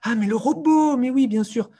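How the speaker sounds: high, loud burst of discovery; delighted pupil realisation. Retold or imitated. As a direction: exclaim like a child who has just cracked it. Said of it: "ils ont des étoiles dans les yeux".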